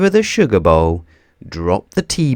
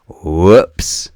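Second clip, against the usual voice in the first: louder, startled little whoops; surprised whoops; 3 semitones lower, 7 dB louder than usual. A narrator's reading. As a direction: react as the handle comes off. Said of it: "said Mister Bump".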